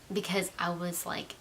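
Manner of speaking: normal talking voice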